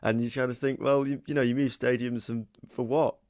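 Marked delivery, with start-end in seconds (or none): none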